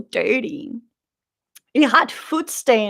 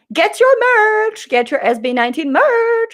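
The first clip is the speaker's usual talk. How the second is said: street vendor calling out; 12 semitones higher than usual. Retold or imitated. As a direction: hawk the merch like a street seller. Sellers calling out merch at car.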